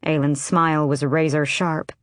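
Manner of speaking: usual speaking voice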